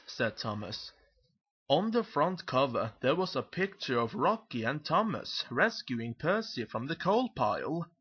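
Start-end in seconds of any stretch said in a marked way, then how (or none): none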